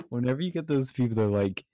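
ordinary delivery

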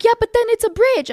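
high, loud mock protest; protesting driver. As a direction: imitate a flustered driver objecting.

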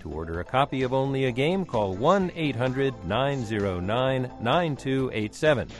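usual speaking voice

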